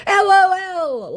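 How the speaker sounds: high, slow put-on laugh-read; mocking the scammer's reply. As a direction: spell it out like a smug scammer. Voicing the scammer.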